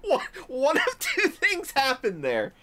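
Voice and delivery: laughing. Real, not acted. Cracking up at the clip.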